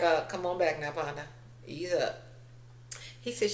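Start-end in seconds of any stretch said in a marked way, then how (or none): 0.2-2.2 much softer, calming murmur; soothing Belle. Retold or imitated. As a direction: ease him down gently. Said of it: "Belle touched him like".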